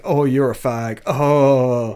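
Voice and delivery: loud, hammed-up mock taunt; mock jeer. Reviewer imitates the film's unfunny slur jokes, loud mock jeer. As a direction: imitate a crude joker, drawing out the reaction.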